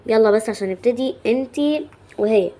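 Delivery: loud, drawn-out pretend teacher's order; bossy teacher. Teacher rounding up two squabbling girls to begin, loud and bossy. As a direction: play a teacher calling the class to start, slow and loud.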